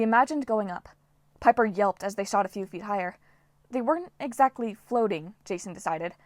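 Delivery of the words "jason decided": ordinary delivery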